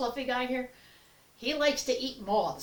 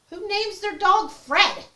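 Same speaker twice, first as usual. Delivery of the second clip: incredulous; 12 semitones higher, 8 dB louder, slower than usual. Real, not acted. Incredulous rhetorical question.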